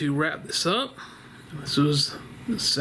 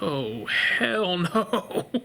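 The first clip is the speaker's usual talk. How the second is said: very slow, drawn-out oh hell no; scared refusal; much slower than usual. Retold or imitated. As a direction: stretch it out in horror. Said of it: "I was like".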